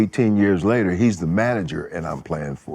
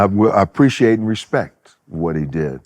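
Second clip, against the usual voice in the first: ordinary talk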